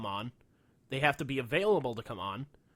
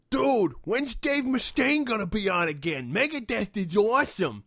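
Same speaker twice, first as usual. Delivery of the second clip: slow, high, loud dopey fan; mock dim fan, louder and higher; dumb fan voice; 7 semitones higher, 7 dB louder, slower than usual. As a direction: ham up a clueless metalhead. Fan asking for [name].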